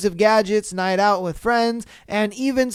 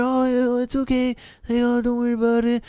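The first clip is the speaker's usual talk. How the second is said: put-on a touch of a meek boyfriend; 4 semitones higher than usual. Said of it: "He wants to sit there".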